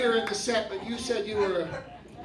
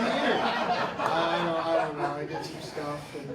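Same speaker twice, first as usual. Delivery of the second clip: clueless guy; 6 semitones lower, slower than usual. Acted. Impersonating the clueless audience member.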